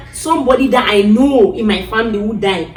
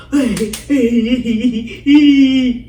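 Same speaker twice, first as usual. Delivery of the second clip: wordless wail; 3 semitones higher, 4 dB louder than usual. Retold or imitated. Woman retelling waking up from the trance, long wail. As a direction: let out a drawn-out cry on waking alive.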